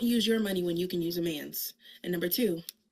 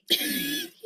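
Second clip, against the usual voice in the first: high bright laugh breaking out; sudden high-pitched laugh; burst of laughter. Real, not acted. Mother laughs at daughter's blunder.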